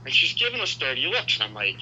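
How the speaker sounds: ordinary delivery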